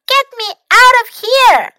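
very high, loud little cry; tiny voice. A narrator's reading. Louis stuck in a box, tiny voice calling. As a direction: squeal for help in a tiny voice. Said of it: "said a tiny voice".

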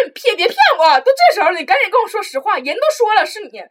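mock angry teacher. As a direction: imitate the teacher demanding the truth. Teacher accusing her, voiced. (模仿老师生气地逼问)

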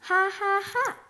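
high cackling gull laugh. A narrator's reading. Gulls over the island laughing high and bright. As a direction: cackle like gulls wheeling overhead. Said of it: "cried the laughing gulls".

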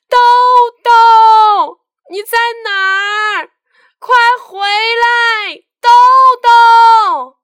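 very high, loud, tearful shouting; mother calling her lost son from far away, crying out; mother's desperate call. Acted. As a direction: call from afar, half crying with worry. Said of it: "这是妈妈的声音".

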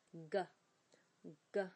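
g with the voice buzzing; voiced g. Shown as a demonstration. Teacher shows the voiced g sound. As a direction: let the throat vibrate on g. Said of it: "因为g是一个浊音".

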